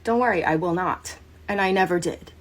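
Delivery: usual speaking voice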